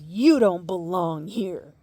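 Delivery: loud, slow, cold accusation; hostile woman. Retold or imitated. Stranger telling a kid off in the women's room, loud and cold. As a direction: bar her from the restroom, loudly. Woman confronting speaker in restroom.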